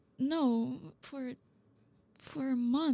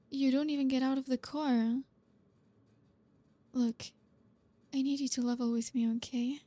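usual speaking voice

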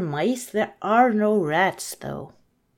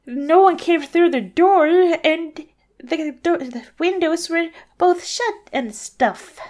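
Cinderella voice; 7 semitones higher, 4 dB louder than usual. Acted. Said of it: "Cinderella was like".

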